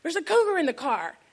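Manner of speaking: high-pitched mock panic; mock screaming. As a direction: cry out to dad in pretend alarm. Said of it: "he screams".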